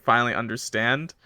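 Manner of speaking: ordinary talk, nothing marked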